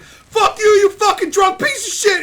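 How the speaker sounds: high, loud mock outburst; imitated pissed-off yell. Angry dad cursing out the streamer, imitated furious yell. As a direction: explode like the insulted dad, voice way up. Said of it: "that guy got so fucking pissed".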